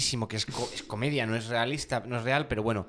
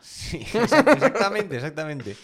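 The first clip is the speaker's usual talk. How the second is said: riendo; 4 semitones higher, 8 dB louder than usual. Real, not acted. Se ríe confirmando algo gracioso.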